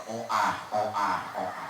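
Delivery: "oah" lisped loud and low; joining in the baby-talk lisp; lisping too. Acted. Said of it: "aing ge pan jadi melu cadel". ("oah" cadel, tarik)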